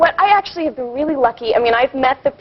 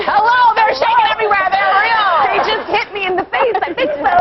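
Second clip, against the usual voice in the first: high-pitched comic protest; mock indignant; 9 semitones higher than usual. Guest answering whether they are real, high mock indignation. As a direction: protest in playful exasperation, pitched up.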